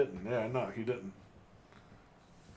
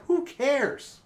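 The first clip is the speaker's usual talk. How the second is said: dismissive; 7 semitones higher than usual. Retold or imitated. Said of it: "And I'm like".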